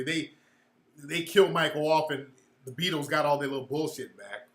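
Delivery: usual speaking voice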